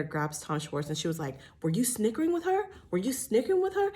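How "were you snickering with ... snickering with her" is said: high-pitched, insistent accusing question; mock interrogation. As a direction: imitate her demanding an answer, pitched way up. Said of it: "totally gave me, like".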